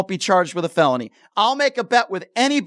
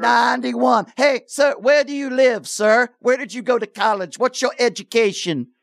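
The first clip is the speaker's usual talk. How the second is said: higher, jeering interrogation; mocking caller's lingo; 5 semitones higher than usual. Host grilling the caller back in his style, jeering. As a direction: needle him, higher and pushy. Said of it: "after I started to speak his language".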